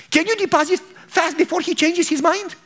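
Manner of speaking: eager hurry. Acted. Man urging bank clerk.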